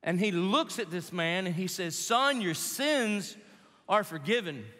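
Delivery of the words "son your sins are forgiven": slow tender pronouncement. Acted. Jesus pronounces forgiveness.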